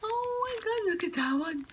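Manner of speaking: thin, high-pitched gushing; delighted squeal. Real, not acted. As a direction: squeal softly over something adorable. Delighted by cat-themed gifts.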